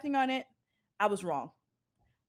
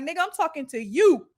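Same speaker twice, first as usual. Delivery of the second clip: loud, higher, sharp comeback; retold sharp retort; 6 semitones higher, 7 dB louder than usual. Friend retells snapping at her friend, loud and higher. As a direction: snap back at her loudly.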